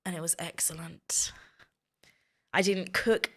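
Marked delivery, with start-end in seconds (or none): 0.0-1.3 almost whispered, breathy with relish; a fond whisper about a lovely trip; hushed. Real, not acted. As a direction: let it out as a soft, savouring whisper. Fond recall of a happy trip.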